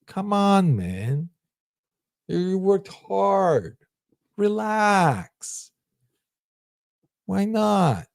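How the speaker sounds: high, sweet coaxing voice; pleasant tempting voice. Pretend. Lazy inner voice coaxing you to stay in, high and sweet. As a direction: tempt yourself gently to relax. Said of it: "It's a really nice voice".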